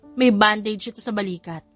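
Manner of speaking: ordinary delivery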